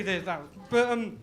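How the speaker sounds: usual speaking voice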